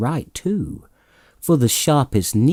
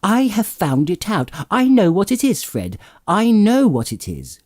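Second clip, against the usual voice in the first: cried out; 11 semitones higher than usual. A narrator's reading. Plump sister crying out the answer to Fred, high and triumphant. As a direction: blurt out the discovery in delight. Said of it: "cried out".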